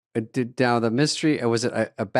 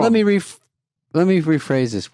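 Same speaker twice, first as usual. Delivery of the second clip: higher, louder wiseguy menace; mock mob heavy; 7 semitones higher, 5 dB louder than usual. Podcast host riffing as the hotel goon, loud mock menace. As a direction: ham up a goon rephrasing his threat. S1 joins the guard bit.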